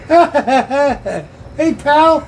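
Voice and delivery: high, giggly creature voice; cute creature voice. Pretend. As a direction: play a giggling little critter. Voicing a laughing water bear.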